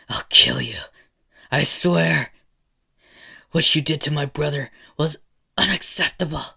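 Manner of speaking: loud, rising vow of revenge; furious threat. Acted. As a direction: shout it up from the ground, beaten but enraged. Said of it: "falls down to the ground and says".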